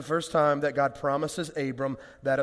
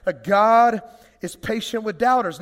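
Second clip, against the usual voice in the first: repeated louder with more push on "God is patient"; 3 semitones higher, 6 dB louder than usual. Shown as a demonstration. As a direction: say "That God is patient with doubters." again to wake the room. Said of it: "Ten o'clock?".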